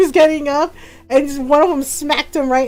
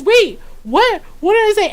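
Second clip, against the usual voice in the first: retold bewilderment; 6 semitones higher than usual. Bewildered boyfriend in retold story.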